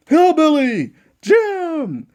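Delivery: ring announcer call. Pretend. Ring announcer introduces Hillbilly Jim, slow drawn-out call. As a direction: stretch out "Hillbilly Jim" slowly, announcer style.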